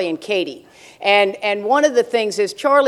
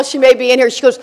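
normal talking voice

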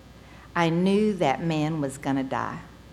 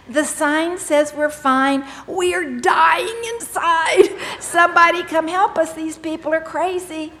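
mock rooftop cry for help; 10 semitones higher, 5 dB louder than usual. Daughter calling out from the rooftop.

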